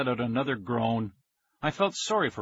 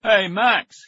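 loud, raised hearty shout; booming; 8 semitones higher, 8 dB louder than usual. A narrator's reading. Dad teasing his son at dinner, booming shout. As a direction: boom it across the table. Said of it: "he boomed".

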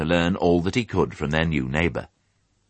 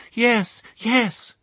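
very high little-pig voice; shaken piglet. Acted. Piglet admitting nightmare to mother, high and shaken. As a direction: answer as a frightened piglet.

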